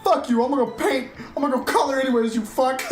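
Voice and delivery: high, loud mock telling-off; lightly played viewer snapping back at the streamer; imitating Justin. Viewer Justin telling the captain off, high mock rant. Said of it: "I thought he was gonna be like".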